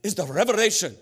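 high, loud Norwegian proclamation; mock-solemn Norwegian accent with dramatic pauses. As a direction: deliver the big reveal as a grand Norwegian preacher. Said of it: "And he said".

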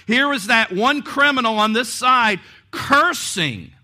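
normal talking voice

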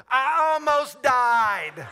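very loud, high, stretched outburst; hammed-up yell. Pilot phoning his instructor after landing, hammed-up yell.

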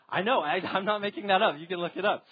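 loud, laughing insistence; amused protest. Real, not acted. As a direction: protest through a laugh, voice lifted. Laughing aside about odd name.

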